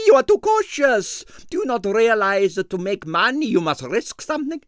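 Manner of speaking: put-on high, heavily accented excitable impresario; Stroganoff's Russian accent. A narrator's reading. Ballet impresario to the detective, in a Russian accent.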